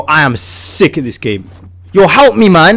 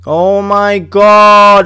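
ordinary delivery

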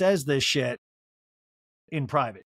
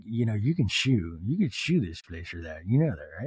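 low, slow put-on voice; Meredith impression; 7 semitones lower than usual. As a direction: drop the voice way down, slow and drawn out. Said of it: "I just wanted Meredith to be like".

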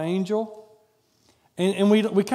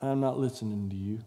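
lower, softer dismissal; turning away the voice lower and softer; calm refusal; 8 semitones lower, 6 dB quieter than usual. Retold or imitated. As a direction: say it quietly and final. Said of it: "I could have easily said".

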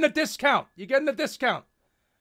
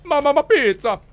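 mock Italian accent.

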